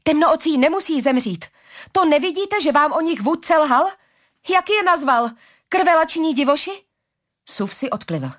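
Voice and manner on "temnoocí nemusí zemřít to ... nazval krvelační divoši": high, urgent protest; impassioned argument. A narrator's reading. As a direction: argue passionately to make them see. Heroine pleads with the hostile guards.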